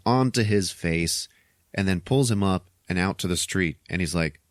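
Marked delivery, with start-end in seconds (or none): none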